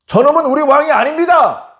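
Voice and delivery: loud, very high outcry; indignant shout. Acted. Chief priests reject the prisoner before Pilate, high indignant shout. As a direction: shout a vehement public denial.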